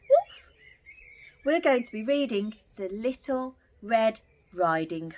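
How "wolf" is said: high, loud squeal on wolf; spooky wolf. Acted. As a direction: sing out wolf with a big rise. Big bad wolf for kids.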